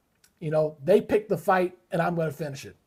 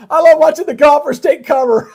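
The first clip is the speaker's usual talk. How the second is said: much louder and higher through laughter; delighted, talking through a laugh; real laughing excitement; 8 semitones higher, 14 dB louder than usual. Real, not acted. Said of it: "kinda got worked up there".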